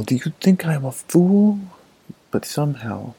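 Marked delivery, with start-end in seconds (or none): 0.0-1.6 high, loud indignant yell. A narrator's reading. Hunter yelling at the majestic deer, loud indignant yell. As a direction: shout it at the deer, much higher and louder. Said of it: "Gurudra wanted to yell".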